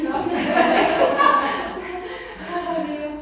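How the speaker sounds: loud laughing protest. Real, not acted. Laughing retort about next year.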